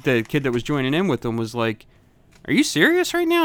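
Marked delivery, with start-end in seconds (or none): 2.5-3.4 high, loud disbelieving challenge; the kid squaring up in disbelief; incredulous challenge. Retold or imitated. Retold kid challenging him, loud and incredulous. Kid confronting in school fight.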